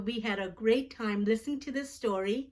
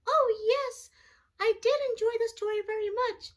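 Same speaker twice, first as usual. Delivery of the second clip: much higher puppet voice for a little friend; Jackson puppet voice; 12 semitones higher, faster than usual. Pretend. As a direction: give the puppet a high childlike voice. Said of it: "our friend Jackson".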